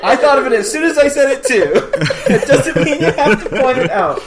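laughing. Real, not acted. Laughing while scolding co-host.

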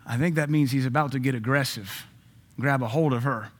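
ordinary talk, nothing marked

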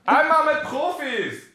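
high, slow, drawn-out outcry; exasperated shout. Real, not acted. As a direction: throw up your hands in despair.

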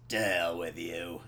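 low, slow celebrity impression; Nick Nolte. Comic imagining an actor's mugshot moment, low slow impression. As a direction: drop it low and drag it out. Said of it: "that's exactly how he sounds".

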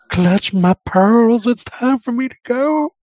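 very high, slow, loud swooning whine; pearl-clutching whine. Retold or imitated. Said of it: "snivelly little thing that he turned into".

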